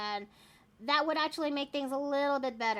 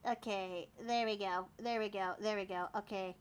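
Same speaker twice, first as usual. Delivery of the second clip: muttering to self; 6 semitones lower, 8 dB quieter than usual. Real, not acted. Fixing stream, talking to self.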